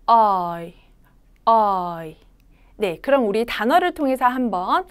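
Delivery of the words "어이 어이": 어이 said softly, mouth between 오 and 어 gliding to 이; a gentle 어이, not a crisp 오이; soft oi sound. Shown as a demonstration. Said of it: "다시 한번 해볼까요?". (어이를 부드럽게)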